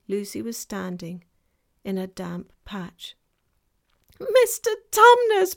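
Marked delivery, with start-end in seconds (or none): 4.2-5.5 very high and loud bawl. A narrator's reading. Lucy shouting into the faun's ear, loud bawl. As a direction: bellow right into his ear. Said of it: "bawled Lucy in his ear".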